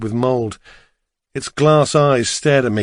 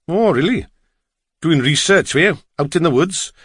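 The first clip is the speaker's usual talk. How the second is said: higher, lilting Welsh cabbie; Welsh accent; 4 semitones higher than usual. A narrator's reading. Driver quizzes his passenger, lilting Welsh accent. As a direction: ask in a Welsh singsong. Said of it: "his strong Welsh accent".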